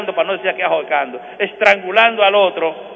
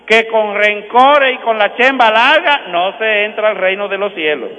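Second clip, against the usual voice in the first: fiery preaching warning; 6 semitones higher, 5 dB louder than usual. Acted. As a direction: thunder the warning from the pulpit. Warning radio listeners who won't forgive.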